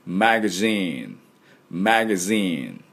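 slow "マガジン" with buzzed z and long zeen; "マガジン" as English magazine, ae vowel. Shown as a demonstration. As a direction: stretch the last syllable of "マガジン". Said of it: "ジーンはちょっと伸ばします".

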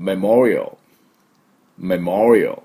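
メモリアル as "meh-MOR-ee-uhl"; メモリアル in English, swelling on the second syllable; correct English pronunciation. Shown as a demonstration. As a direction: stress "MOR" in メモリアル and end on a dark l. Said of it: "私の後に発音しましょ".

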